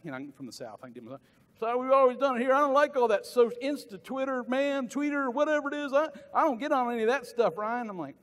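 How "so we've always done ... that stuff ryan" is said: higher, louder hammed-up Southern accent; Southern good old boy, hammed up. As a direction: play the stubborn Southern guy who won't touch social media. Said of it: "you don't have to do the accent".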